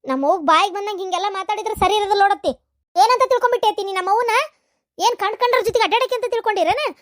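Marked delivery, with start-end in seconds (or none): 3.0-6.9 higher, fast, heated demand; angry challenge. Acted. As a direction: fire the question back at her. Niece angrily challenging aunt.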